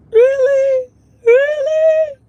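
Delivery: high, loud tearful squeak; mock tearful. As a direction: squeal it like retold crying. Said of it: "And I started crying".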